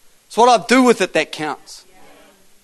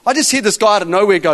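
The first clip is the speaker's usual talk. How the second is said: ordinary talk, nothing marked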